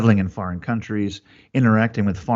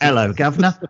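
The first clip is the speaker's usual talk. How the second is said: hammed-up British "governor" greeting, higher and louder; mock British accent; 5 semitones higher, 4 dB louder than usual. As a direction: playfully put on a British accent.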